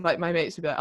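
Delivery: ordinary delivery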